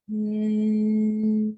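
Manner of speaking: long steady hum through closed lips; bee humming. Shown as a demonstration. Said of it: "create a humming sound like a, a bee".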